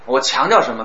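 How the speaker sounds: normal talking voice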